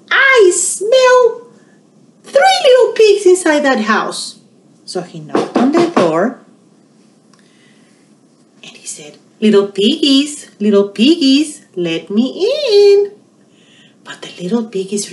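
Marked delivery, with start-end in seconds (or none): 0.1-4.4 high, loud growly wolf threat; big bad wolf. Wolf voiced in story. 9.4-13.2 lightly voiced coaxing wolf, higher; sing-song call to the pigs; wolf at the door.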